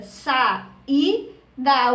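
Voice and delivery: "Sa, i, dá" split into three separate syllables; saída broken into syllables. Shown as a demonstration. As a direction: say "Sa", "i" and "dá" each as its own beat. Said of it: "Como é que eu separo saída?".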